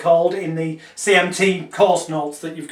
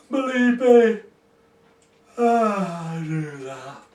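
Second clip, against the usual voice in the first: a yawn breaking through; yawny voice; 3 dB quieter, slower than usual. Shown as a demonstration. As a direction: let a real yawn into it. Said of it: "and yes, I do talk like this, so it's yawny".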